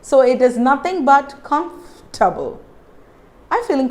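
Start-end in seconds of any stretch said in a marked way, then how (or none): 1.4-2.6 "comfortable" as KUMF, tuh-bul; silent O. Shown as a demonstration. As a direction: break after the F in "comfortable". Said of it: "stop pronouncing the letter".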